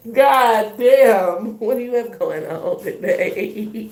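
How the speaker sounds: loud high astonished outburst, slow; stunned exclamation. Real, not acted. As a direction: exclaim it slowly, amazed. Reacting loudly to the cards.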